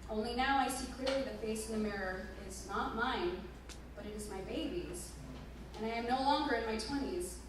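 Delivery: ordinary delivery, nothing marked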